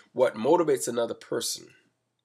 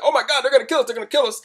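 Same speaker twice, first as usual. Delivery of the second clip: panicked scream; 13 semitones higher, 6 dB louder, faster than usual. Retold or imitated. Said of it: "she got".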